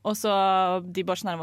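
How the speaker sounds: ordinary talk, nothing marked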